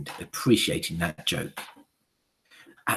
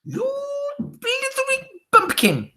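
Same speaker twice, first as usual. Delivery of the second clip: very high, slow furious screech; Grand High Witch screeching at the foolish witch; 5 dB louder, slower than usual. Acted. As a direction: screech the insult in rage. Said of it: "screeched the Grand High Witch".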